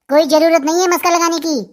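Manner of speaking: ordinary talk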